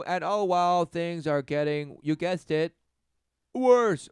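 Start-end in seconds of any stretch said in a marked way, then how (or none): 3.5-4.1 much higher, louder punched word; loud high ranting hit; emphatic outburst. Real, not acted. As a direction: blast the word out.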